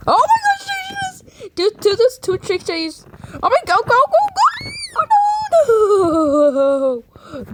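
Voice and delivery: high panicked shouting; scared horror game streamer. Real, not acted. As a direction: scream in real panic. Monster attack in game.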